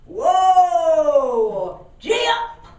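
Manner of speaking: loud bellowed horse commands; boatman's shout. Retold or imitated. Boatman shouting commands at his pony, loud. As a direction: holler it down the towpath at the ponies. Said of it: "control the horses by shouting".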